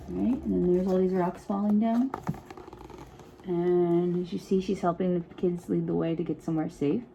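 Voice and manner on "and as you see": ordinary delivery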